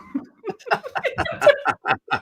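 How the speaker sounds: airy squealing laugh; helpless high laugh; high breathless laugh. Real, not acted. Laughing at her own answer to Chloe, high and breathless.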